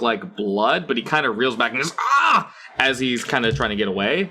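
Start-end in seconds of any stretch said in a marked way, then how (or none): 2.0-2.5 pained cry. Acted.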